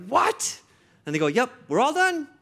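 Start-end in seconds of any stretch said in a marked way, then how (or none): 0.1-0.6 high, loud disbelieving what; pitched-up astonished what; stunned what. Retold or imitated. Father's stunned reply, retold. 1.3-2.3 breezy doctor. Retold or imitated. Doctor repeats, retold.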